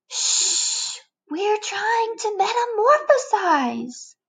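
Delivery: high, slow annoyed shush; irritated high shushing; shushing caterpillar. Acted. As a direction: hush the noisy bug.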